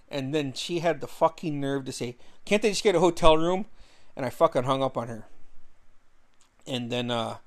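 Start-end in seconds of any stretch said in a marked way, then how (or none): 2.5-3.7 louder, higher, hurried mimicry; indignant quoting. Quoting girlfriend about his allergic sisters, indignant mimicry. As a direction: throw her question back in mock voice. Quotes girlfriend's outrageous suggestion.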